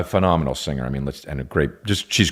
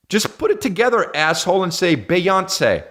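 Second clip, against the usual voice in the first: hammed-up exasperation; mock exasperated scolding; 11 semitones higher than usual. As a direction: lecture them like a fed-up teacher. Scolding imagined fan.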